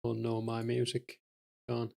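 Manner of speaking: ordinary delivery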